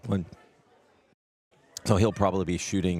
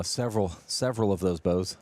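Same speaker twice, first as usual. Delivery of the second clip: usual speaking voice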